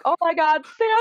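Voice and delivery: squealing with joy, pitch climbing up high on "Santa"; excited elf shriek. Retold or imitated. Doing Will Ferrell's elf spotting Santa, high excited squeal. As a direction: burst out like a child who just spotted Santa. That same freak out mentality.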